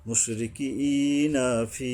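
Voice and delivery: slow chant, long ee in মুশরিকিনা ফি; one-alif elongation. Shown as a demonstration. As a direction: recite slowly, holding the end of ফি. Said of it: "এক আলিফ টানতে হবে". (ফি টেনে ধীরে পড়া)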